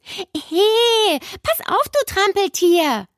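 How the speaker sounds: high-pitched cry of protest; indignant girl's call. A narrator's reading. Nele, nearly shoved off her saddle, calls out indignantly. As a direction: call out sharply at a clumsy classmate. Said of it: "rief Nele".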